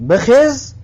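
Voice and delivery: teacher playing the command "بخیز"; mock command. Shown as a demonstration. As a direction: bark "بخیز" at a pupil to get up.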